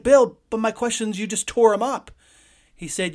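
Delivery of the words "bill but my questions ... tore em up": loud, higher retold complaint; upset rookie pleading with Bill about his questions, angry; furious protest. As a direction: protest hotly over the torn-up card. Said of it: "And I was furious".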